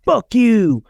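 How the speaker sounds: high, mock-defiant outburst; imitated defiant kids. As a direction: throw it out like kids ganging up. Boys jointly defend each other.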